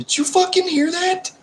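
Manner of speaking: a squeaky, teasing disbelief; mock indignant. Real, not acted. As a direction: act scandalized at the cheeky remark. Mock outrage at friend's joke.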